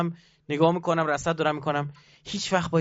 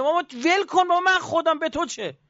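much higher scolding voice; voicing God scolding; 12 semitones higher than usual. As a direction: scold the stubborn servant from above. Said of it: "به بندش داره میگه".

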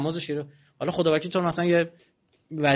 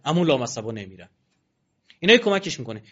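normal talking voice

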